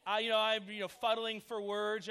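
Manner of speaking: ordinary delivery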